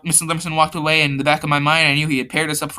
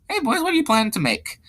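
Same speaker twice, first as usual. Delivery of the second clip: high-pitched put-on teacher voice; teacher's high put-on greeting; bad character voice; 10 semitones higher than usual. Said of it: "Bad voice".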